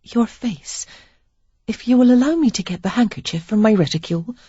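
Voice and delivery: lightly voiced lady offering help; Sarah, careful lady. A narrator's reading.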